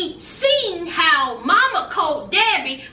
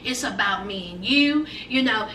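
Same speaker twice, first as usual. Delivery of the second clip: softer and lower, brushing it off; calm your wife down quietly; lightly voiced husband, soft and low; soothing Colt; 6 semitones lower, 6 dB quieter than usual. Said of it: "he was like".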